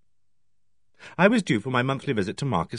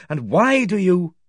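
angry; 7 semitones higher, 5 dB louder, slower than usual. A narrator's reading. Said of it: "my angry lecture".

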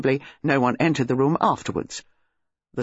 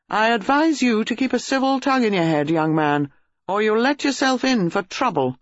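higher, stern official warning; wrathful; 7 semitones higher than usual. A narrator's reading. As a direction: warn the young man with stiff anger. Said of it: "his face red and wrathful".

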